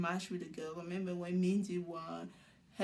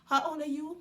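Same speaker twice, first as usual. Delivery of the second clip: mock awkward question, high and louder; raised pitch, imitating awkward first-meeting talk; awkward small talk; 7 semitones higher, 5 dB louder than usual. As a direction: act out a forced getting-to-know-you question. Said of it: "it's not an awkward conversation".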